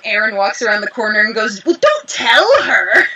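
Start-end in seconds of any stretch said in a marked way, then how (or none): 1.6-3.1 mock scold. Director scolding castmate for spoiler.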